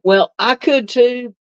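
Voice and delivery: ordinary talk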